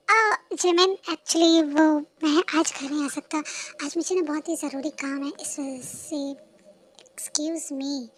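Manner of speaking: ordinary delivery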